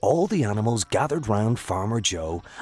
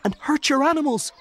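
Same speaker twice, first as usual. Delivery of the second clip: gasp; 6 dB louder, faster than usual. A narrator's reading. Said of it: "said Tom with a gasp".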